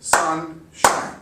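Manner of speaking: "Sunshine" in two clapped beats, "Sun-shine"; clapped syllables. Shown as a demonstration. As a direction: lead the class, "Sun… shine". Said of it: "Try it with me".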